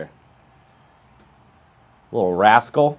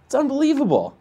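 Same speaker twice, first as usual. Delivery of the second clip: Jerry Seinfeld outraged voice. Real, not acted. Gamer ranting to chat about the Angels, high outraged voice. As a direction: pitch way up in mock outrage at the Angels. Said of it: "a Jerry Seinfeld outraged voice".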